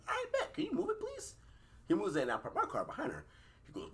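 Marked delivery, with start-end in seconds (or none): 0.1-1.3 much higher, sugary fake-polite ask; mock polite. Mock-polite asking the man blocking his car to move. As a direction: sweet-talk him into moving. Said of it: "I'm like".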